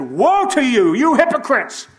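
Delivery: loud, high, furious denunciation; angry woe. Shown as a demonstration. Preacher acting out an angry Jesus, furious shout. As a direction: bellow it like an enraged prophet. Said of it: "Did he said".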